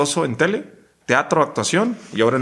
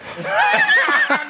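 burst of high laughter. Real, not acted. Host cracks up at the answer.

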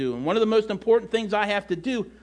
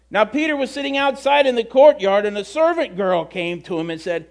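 normal talking voice

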